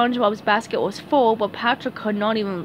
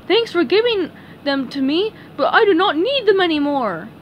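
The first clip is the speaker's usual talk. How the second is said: high cartoon thanks; Patrick; 7 semitones higher, 4 dB louder than usual. Patrick hands the eggs back to SpongeBob, cheerful. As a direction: thank him in a raised cartoon voice.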